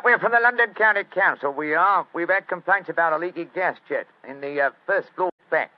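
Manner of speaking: loud, high Cockney workman; brash Cockney council man; Cockney accent. Acted. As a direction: put on a Cockney accent and announce yourself loudly. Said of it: "My Cockney accent doesn't compare with yours".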